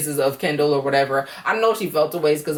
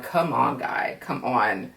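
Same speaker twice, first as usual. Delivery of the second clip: exasperated; 7 semitones lower, 4 dB quieter, slower than usual. Retold or imitated. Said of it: "I was just like".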